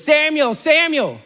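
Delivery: put-on the voice of God calling the boy twice. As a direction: call the name twice, reaching out. God's voice calls twice.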